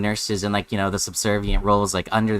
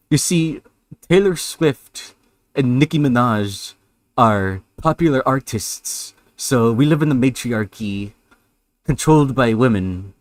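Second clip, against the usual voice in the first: mocking dumb guy voice; 4 dB louder than usual.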